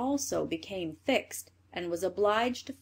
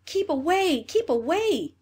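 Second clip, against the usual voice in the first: higher, urgent narrated cry; screamed warning; 8 semitones higher, 4 dB louder than usual. A narrator's reading. Two sisters to the third with the same intention, screamed warning. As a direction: cry it out high, as a storyteller's scream. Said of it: "screamed the other two".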